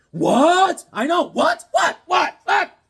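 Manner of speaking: repeated high yelps of disbelief; mock disbelief. Real, not acted. Comic reviewer reacting to twist, high stunned yelps.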